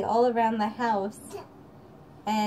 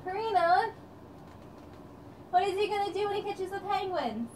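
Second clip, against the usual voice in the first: eager question; 9 semitones higher, 5 dB quieter than usual. Real, not acted. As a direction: pitch it up to tease an answer. Mother quizzing toddler.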